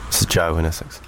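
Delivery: low, listless sign-off; bored. Acted. Long-serving worker signing his problem to the agony uncles, bored. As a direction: sign off wearily, with no interest at all. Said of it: "So just bored".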